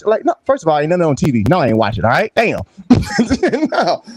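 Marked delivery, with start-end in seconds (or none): none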